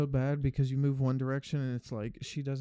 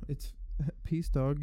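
normal talking voice

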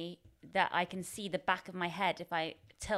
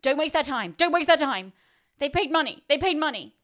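higher, much louder anxious self-warning; frantic inner voice; 8 semitones higher, 10 dB louder than usual. Retold or imitated. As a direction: bark the stage-fright mantra at yourself. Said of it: "I still have that mentality".

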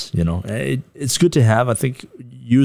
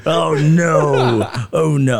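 higher, louder hammed-up alarm; mock dismay; 7 semitones higher, 4 dB louder, much slower than usual. Guest pretends horror at his weak plug, hammed up. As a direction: fake panic over a botched plug. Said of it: "I'm just kidding".

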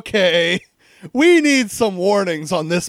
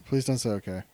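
quiet pleading; 10 semitones lower, 10 dB quieter than usual. Real, not acted. Pleading at game character.

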